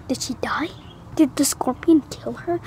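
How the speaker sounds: soft frightened whisper; scared whisper. Retold or imitated. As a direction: whisper in fear. Fearful thought, whispered.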